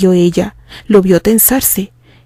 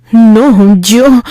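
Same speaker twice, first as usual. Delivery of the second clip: louder, flustered outburst; an upset, louder protest; agitated; 5 dB louder than usual. Acted. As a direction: blurt it out, shaken. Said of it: "se agitó el castaño".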